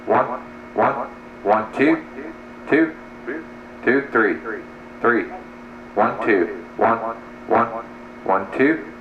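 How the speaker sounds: normal talking voice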